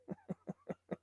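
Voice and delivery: soft, voiceless chuckle; quiet laugh. Real, not acted. Commentator amused before delivering a nickname for Kneecap, quiet laugh. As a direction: laugh quietly under the breath.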